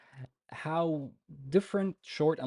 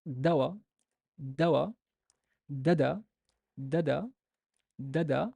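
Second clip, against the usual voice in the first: "Dawa" and "dada" each with a small rising tick; stress drill; 3 dB louder than usual. Shown as a demonstration. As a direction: run the stressed syllables one after another. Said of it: "upper tick of my pronunciation".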